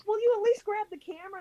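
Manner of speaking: stuck in a bog hole, begging her nephew for help, exasperated; exasperated call out. Retold or imitated. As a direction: call to him, fed up and stuck.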